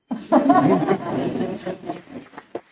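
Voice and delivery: bright rising laugh; real laugh. Real, not acted. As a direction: laugh out the relief of finishing. Reader laughs after finishing.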